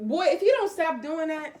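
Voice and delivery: loud, high warning at the dog; loud scolding voice. Real, not acted. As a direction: snap a warning at the pet.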